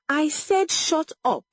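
high, insistent silencing; shouted command. A narrator's reading. Mother cuts off her daughter, shouted command. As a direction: drive it out in a high, angry voice. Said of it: "Egodi shouted again".

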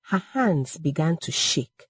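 ordinary delivery, nothing marked

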